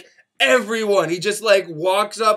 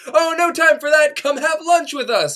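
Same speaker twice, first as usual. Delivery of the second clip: cheery invite; 9 semitones higher, 6 dB louder than usual. Retold or imitated. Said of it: "And they're like".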